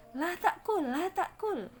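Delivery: "La ta'kul" high, thin and smiling; smiling pronunciation. Shown as a demonstration. Teacher models a command with smiling thin letters. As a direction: say "La ta'kul" high-pitched through a smile. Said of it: "Tapi sambil senyum".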